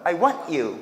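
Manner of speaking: "I want you" with a held T before you; cut between words. Shown as a demonstration. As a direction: stop dead on "want", then "you". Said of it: "끊어서".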